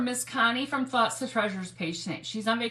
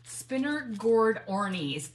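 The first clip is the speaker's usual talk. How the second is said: ordinary talk, nothing marked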